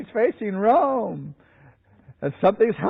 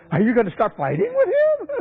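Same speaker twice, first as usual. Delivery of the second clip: incredulous challenge; 7 semitones higher than usual. Retold or imitated. Acted incredulous challenge to Luther.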